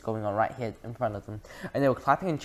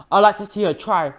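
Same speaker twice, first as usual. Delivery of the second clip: loud, higher blustering dare; defiant shout; 7 semitones higher, 9 dB louder than usual. A narrator's reading. Mister Jenkins daring the witch, loud and defiant. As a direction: bark the challenge. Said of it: "shouted Mister Jenkins".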